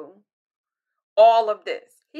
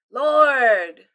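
loud drawn-out call; crying out; 8 dB louder than usual. Retold or imitated. Pastor voicing the desperate heart, loud cry. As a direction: call out Lord in distress.

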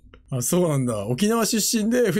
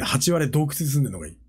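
usual speaking voice

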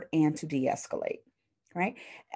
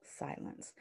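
whispered Silence; 16 dB quieter than usual. Shown as a demonstration. Teacher showing silence to students, whispered. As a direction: breathe the word Silence unvoiced. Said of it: "one that's really key is silence".

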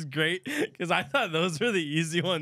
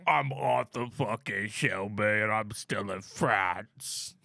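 put-on low, slow British gangster accent; Arthur Shelby; 6 semitones lower, slower than usual. Announcing himself to his brother Tommy, slow British gangster voice. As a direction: draw it out deep, with a hammed-up British accent. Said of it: "[name] does Peaky Blinders".

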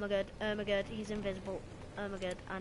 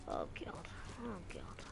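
dismayed mutter; 11 dB quieter than usual. Real, not acted. Gamer to self as the match goes badly, hushed. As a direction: mutter it under the breath, nearly whispered.

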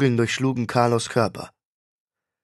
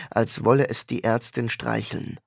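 plain reading voice

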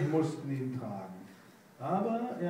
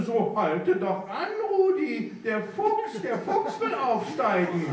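higher, laughing fake alarm; mock alarm; 9 semitones higher, 5 dB louder than usual. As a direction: cry out in pretend panic. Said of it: "rief Schnuddel".

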